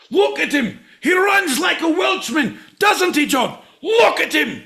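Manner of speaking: Scottish man yelling about a Welshman who ran away; angry Scottish rant. Acted. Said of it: "That's what my Scottish should have been".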